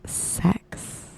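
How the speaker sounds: breathy whispered sultry sex; sexy whisper. Pretend. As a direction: whisper it seductively. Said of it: "say it in your sexy Ann voice".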